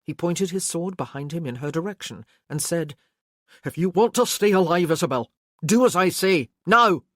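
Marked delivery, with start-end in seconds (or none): 3.6-7.0 loud, building to a shouted now; forceful command. Acted. Care forces Isabel to obey, shouting at the end. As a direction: bark the order, last word loudest.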